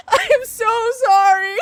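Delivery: high, loud, slow sorry through laughter; laughing apology. Real, not acted. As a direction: apologize mid-giggle. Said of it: "I get worked up and excited".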